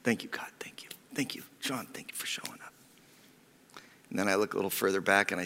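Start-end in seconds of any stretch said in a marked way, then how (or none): 0.0-2.7 hushed, unvoiced whispered gratitude; whispered thanks. Pastor spotting an old mentor in church, whispered thanks. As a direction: murmur thanks under your breath. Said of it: "and I'm like".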